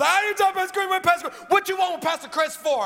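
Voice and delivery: very high, urgent, pleading for the pastor; high insistent woman's voice; high frantic woman. Retold or imitated. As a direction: replay the stranger's frantic demand. Retelling both women through door.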